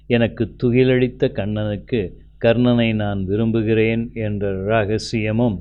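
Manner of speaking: usual speaking voice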